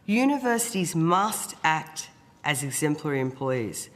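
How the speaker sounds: normal talking voice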